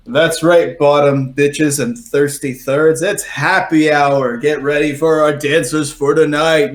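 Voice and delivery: loud, drawn-out happy hour announcer; projected announcer. Acted. Happy hour host calls out to the whole bar, projected announcer. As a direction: project it like a hype emcee, stretching the words. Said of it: "voice is, like, projected through".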